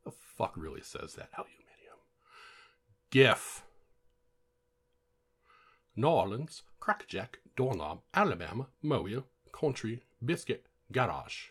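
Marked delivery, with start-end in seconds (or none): 1.4-2.0 very soft mock-English "Aluminium"; muttered English word. Shown as a demonstration. Doubting anyone says that, muttered in mock English. 3.1-11.5 loud, higher, badly faked English accent; shitty English accent. Shown as a demonstration. Trying the word list in other accents, hammed-up English accent. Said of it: "That was my shitty English accent".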